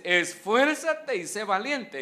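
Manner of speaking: slow, weighty "Esfuérzate y sé valiente"; emphatic scripture quote. Acted. Preacher quoting God's charge to Joshua, slow and weighty. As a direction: land each word of the command.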